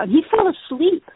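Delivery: usual speaking voice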